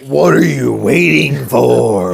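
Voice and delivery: slow, drawn-out announcer mimicry; mock monster truck commercial voice; promo voiceover imitation. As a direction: stretch each word like a truck-show promo. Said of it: "I had the door open and I'm like".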